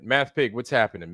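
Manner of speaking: ordinary delivery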